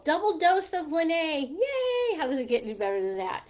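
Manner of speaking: squealing with delight, quoting a friend; a gleeful "yay", then rushing on; excited cheer. Retold or imitated. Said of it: "Jean's all".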